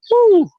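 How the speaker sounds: loud, high-pitched "woo"; whooped out, reliving being spent; exhausted whoop. Retold or imitated. Reliving exhaustion after wrestling matches.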